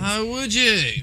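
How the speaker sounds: put-on high, drawn-out English accent; mock English accent. Replying to an accent request, playful high English accent. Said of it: "Talking of English accents".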